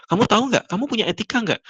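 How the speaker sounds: high-pitched indignant scolding questions; mock outraged, voice jumping high; imitated angry boss. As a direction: act out a boss telling off staff. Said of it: "si ibu ini marah".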